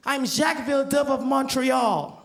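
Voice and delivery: high, proud announcement; the boy naming himself to the official. Acted. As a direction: announce the name grandly. Kid voiced proudly.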